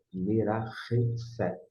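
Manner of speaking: slow "merarefet" with a raspy h in the third syllable; Hebrew pronunciation demo. Shown as a demonstration. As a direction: model "merarefet" in careful joined syllables. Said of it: "Esse rei é mais".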